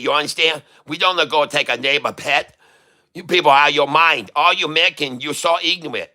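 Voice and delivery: mock-indignant fake Chinese accent. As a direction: scold in a put-on Chinese accent. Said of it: "I'll talk like a Chinese".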